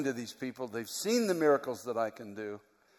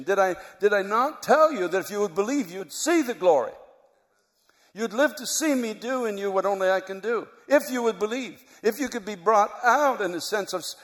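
higher and louder, driving the sermon home; full-force preaching; 7 semitones higher, 4 dB louder than usual. Acted. Preacher urging the congregation, higher and louder, full force. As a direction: preach it hard, pitch climbing with each line.

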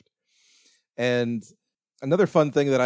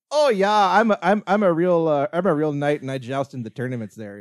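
high, loud mock Wisconsin accent; 4 semitones higher, 6 dB louder than usual. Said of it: "Wisconsin accent".

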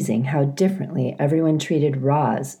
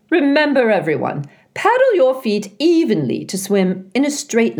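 put-on old goose Loudwing; 5 semitones higher than usual. A narrator's reading. Loudwing waddling into the garden, hearty goose voice. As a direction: hail them like a jolly old goose.

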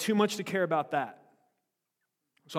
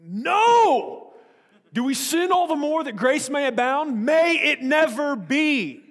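angry Paul; 10 semitones higher, 11 dB louder, slower than usual. Retold or imitated. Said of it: "he had an angry vein".

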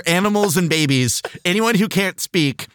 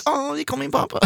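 much higher, softer doting coo; 12 semitones higher, 6 dB quieter than usual. Retold or imitated. Host cooing back at his dog in high voice. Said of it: "I'll be like".

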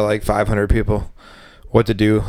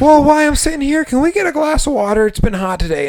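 much higher, needy tourist voice; whiny customer. Retold or imitated. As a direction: beg for water like a helpless tourist. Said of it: "customers at the bar".

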